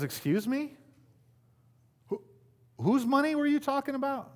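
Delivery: lightly voiced God, mock offended. Pastor voicing God catching the congregation out, mock offended. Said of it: "And God goes".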